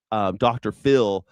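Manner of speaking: normal talking voice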